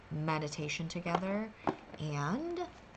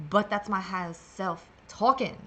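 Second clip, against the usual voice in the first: sassy; 2 semitones higher, 6 dB louder than usual. Real, not acted. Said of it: "I don't know why I sound sassy".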